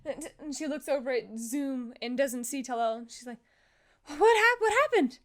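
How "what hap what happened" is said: higher, louder, startled question; urgent, worried cry; acted alarm. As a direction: stammer it out in sudden alarm. Jin alarmed at missing Talal.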